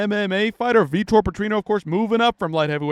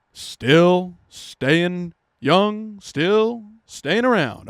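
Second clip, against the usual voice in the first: mock drawn-out; much slower than usual. Commentator teasing an aging fighter, very slow mocking drawl. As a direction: stretch every word out very slowly, mocking.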